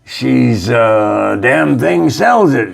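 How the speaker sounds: put-on high cautionary old pirate. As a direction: warn like a seasoned sea dog. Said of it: "the other pirate says to him".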